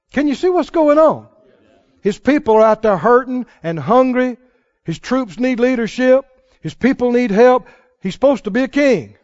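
rally the congregation; loud pulpit cry; preaching. Real, not acted. Preaching at full force.

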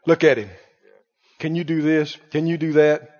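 low, calm voice of God; a hint of God boasting of his horse; lightly voiced God. God showing Job his war horse, lightly voiced. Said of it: "'Cause he said".

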